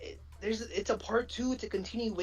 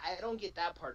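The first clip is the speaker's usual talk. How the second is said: ordinary delivery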